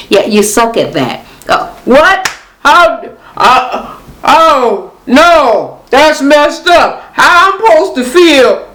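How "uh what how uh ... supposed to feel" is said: mock dismay, pitched up in disjointed bursts; bad acting. Viewer mimicking a horrible actor's scene, badly faked upset. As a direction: overdo the hurt like a hopeless actor. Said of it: "Moreland is a horrible actor".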